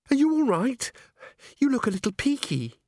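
put-on high, girlish fussing; chirpy concern. A narrator's reading. Christine checks on tired Agnes at breakfast, chirpy concern. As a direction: fuss over a friend in a high little voice.

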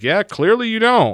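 high, loud mocking retort; imitated fed-up snap, high and loud; exasperated mother. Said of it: "And she's like".

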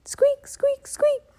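very high squeaky mouse chirps; mouse squeaks. Acted. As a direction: squeak away like mice. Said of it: "they said together".

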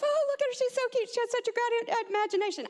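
high, flustered cooing; gushing lawyer. Retold or imitated. Lawyer covering for the girl in court, high gushing. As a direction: gush over the child in a high voice. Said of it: "the lawyer for us starts fumbling".

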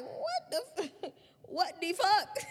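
high, laughing what the fuck; stunned kid cracking up in disbelief. Retold or imitated. Retelling hearing Alvin is her daddy, laughing shocked. Six-year-old shocked by news.

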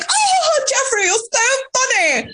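very high, gushing put-on voice; squeaky mock flirtatious gushing; mock fawning wife. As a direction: imitate a flattering dinner hostess, way up high. Mocking wife flattering Epstein at dinner.

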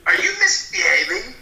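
mock parental scolding. Papa asking the boys, mock parental scolding. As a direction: check on naughty children with mock sternness.

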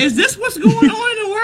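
high-pitched, laughing incredulous cry; squeaky-high mock bewilderment, near laughter; mock disbelief. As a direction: wail it in comic disbelief. Mock outrage at a absurd script.

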